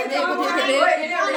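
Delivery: slow, stretched-out calling to look; slow, stretched call to look at Pari; slow drawn-out look. Real, not acted. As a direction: draw each word out slowly.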